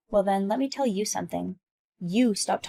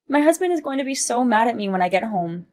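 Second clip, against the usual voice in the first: ordinary talk, nothing marked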